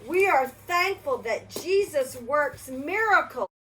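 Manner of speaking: plain reading voice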